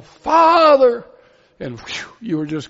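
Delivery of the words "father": high, loud yell of Father. Retold or imitated. Imitating David Weatherly yelling Father from the pulpit. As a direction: cry out Father at full force. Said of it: "He would yell".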